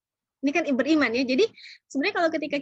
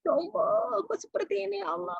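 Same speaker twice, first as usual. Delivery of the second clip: keluhan hati terguncang; 7 semitones higher than usual. Acted. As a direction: act out a shaken plea to God. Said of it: "Ini bergoncang di dada nih". (ratapan lirih bernada tinggi)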